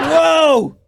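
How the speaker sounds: loud, high burst of shocked delight; surprised whoa. Real, not acted. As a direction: caught off guard, laughing. Reacting to a rude joke.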